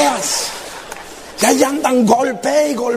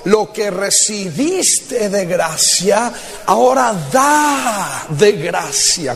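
slow scripture reading; 3 semitones lower, much slower than usual. Real, not acted.

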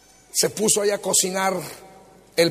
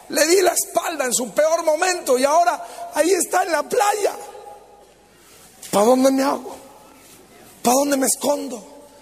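panicked; 6 semitones higher than usual. Acted. Peter spots Jesus on the beach, panicked and frantic. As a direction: rush it out in rising panic.

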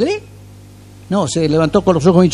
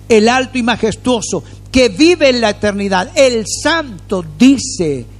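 slow, higher, drawn-out recitation; solemn scripture reading; 5 semitones higher than usual. Acted. A preacher rereading the verse for emphasis, slow and solemn. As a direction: linger on every word of the verse.

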